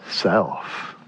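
whispered one-word answer; breathy hushed reveal. Real, not acted. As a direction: drop to a whisper for the punchline. Answer dropped to a hush.

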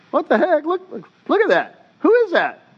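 high-pitched, startled exclamations; astonished. Retold or imitated. Disciples seeing someone walking on water, astonished. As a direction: act out amazed disbelief at a strange sight.

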